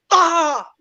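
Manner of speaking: high drawn-out cry; real cry out. Real, not acted. Watcher reacting to a live penalty, high cry. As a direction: yell out high as the penalty is taken.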